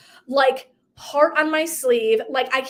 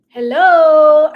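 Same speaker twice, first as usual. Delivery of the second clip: loud, higher "Hello"; mock call-out; 5 semitones higher, 12 dB louder than usual. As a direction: call out to wake someone up. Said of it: "I needed someone to be like".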